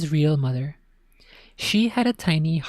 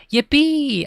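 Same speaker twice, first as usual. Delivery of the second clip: high, loud yippee; a boy's delighted cheer; joyful shout; 11 semitones higher, 5 dB louder than usual. Acted. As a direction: whoop with glee. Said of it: "I shouted".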